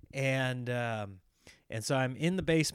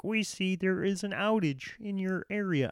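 higher, flat automated voice; robot voice; 5 semitones higher than usual. Retold or imitated. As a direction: read it like a phone robot. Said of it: "that's my robot voice".

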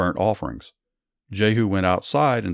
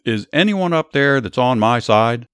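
raised-voice yell up to a window, narrated; louder and higher, a storyteller's moderate yell; 4 semitones higher, 5 dB louder than usual. A narrator's reading. Jehu yells up to those at the window for allies, moderate narrated yell. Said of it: "He looked up at her and then yelled".

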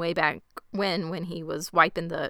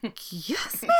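much softer, indignant gasp of a question; hammed-up scandalized disbelief; affronted; 9 dB quieter than usual. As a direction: imitate her shocked offense. Imagined affronted reply to an insult.

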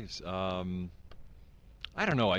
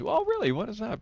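high-pitched curious stranger; mock polite puzzlement. Stranger asking a convention-goer about podcasts, mock puzzled. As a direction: pitch it way up, feigning interest.

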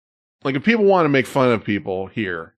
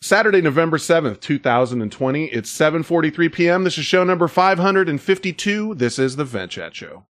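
raised-pitch announcer intro that settles down at the end; show announcer; 4 semitones higher than usual. Acted. Host opening show five hundred fifty-two, announcer style. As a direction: announce the date and show like a host going live.

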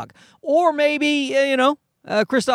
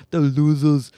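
imitated Austrian accent; Schwarzenegger; 2 semitones lower than usual. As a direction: echo it in a mock Austrian accent. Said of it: "It's just Schwarzenegger wanted to".

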